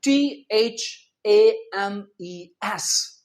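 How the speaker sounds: each letter of टीएचएएमईएस drawn out for the class; टीएचएएमईएस spelt slowly, letter by letter, like a teacher at the board; slow spelling. Shown as a demonstration. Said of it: "आज का हमारा first word है".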